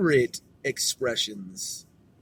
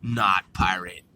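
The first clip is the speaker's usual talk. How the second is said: usual speaking voice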